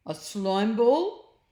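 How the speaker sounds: puzzled slow blob; Slime creature. A narrator's reading. Slime voiced in story.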